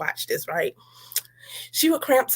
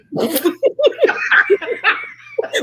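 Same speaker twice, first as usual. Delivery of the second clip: long, high burst of laughter; high laughing fit. Real, not acted. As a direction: lose it laughing.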